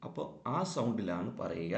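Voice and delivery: ordinary talk, nothing marked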